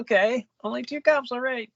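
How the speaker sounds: high-pitched doubtful concession; sceptical acceptance. Retold or imitated. Sceptical reaction quoted after laughing.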